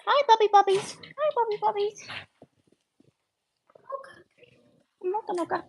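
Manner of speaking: cooing dog voice. Real, not acted. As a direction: coo at the puppies. Greeting puppies in baby talk.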